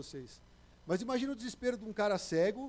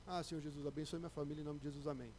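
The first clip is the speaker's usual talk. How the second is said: soft, bored routine prayer; lukewarm prayer; 4 semitones lower, 9 dB quieter than usual. Retold or imitated. Churchgoer praying without real need, soft and indifferent. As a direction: mumble it like a dutiful habit. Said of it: "não vai clamar que nem o Bartimeu".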